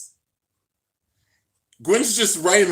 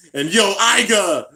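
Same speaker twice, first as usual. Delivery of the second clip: slow, loud hyped call to a favourite; excited call-out; 6 semitones higher, 7 dB louder, slower than usual. Real, not acted. Fan greeting Iga on screen, loud and excited. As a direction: cheer the name out like a fan.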